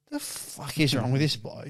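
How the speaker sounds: low, soft disbelieving mutter; muttered judgement. Retold or imitated. Driver in the next car judging him, softly and low. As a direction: imitate a stranger's quiet scorn.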